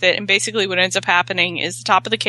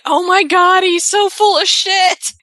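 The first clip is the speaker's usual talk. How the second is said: outraged; 9 semitones higher, 5 dB louder than usual. Retold or imitated. Wife realizing husband lied about the cake, mock outraged. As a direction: wail it like a wife who's been duped.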